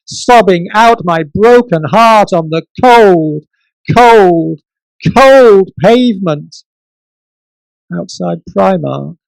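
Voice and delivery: exasperated dramatic recitation; poetry performance. Acted. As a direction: build to a hammed-up lament. Performance poem to Miranda.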